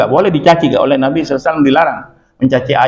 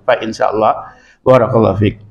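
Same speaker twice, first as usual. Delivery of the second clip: usual speaking voice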